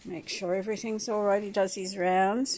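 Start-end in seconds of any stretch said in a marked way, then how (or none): none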